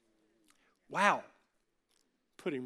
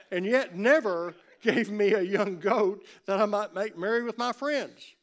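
loud, high, bitter complaint; resentful older son; 5 semitones higher, 11 dB louder than usual. Acted. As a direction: let the older son's grievance burst out. Older son complains, voiced loudly.

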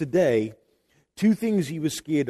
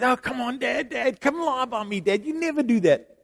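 put-on higher, coaxing little boy's voice; pleading child; 9 semitones higher than usual. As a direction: beg Dad like a small son. Son begging dad to lie down.

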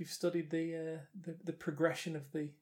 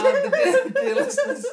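laughing mock-grand; 12 dB louder than usual. Mock-grand address to listeners.

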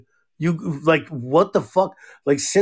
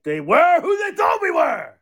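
high, shouting mock press-conference meltdown; coach rant impression; 11 semitones higher than usual. Quoting the coach's famous postgame rant, hammed-up shout. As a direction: yell it like a coach losing it at the podium. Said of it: "it's like".

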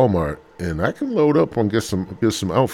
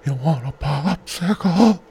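put-on much higher, slower creepy old man voice; old Family Guy neighbour; 10 semitones higher, much slower than usual. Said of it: "be like".

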